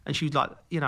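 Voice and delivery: usual speaking voice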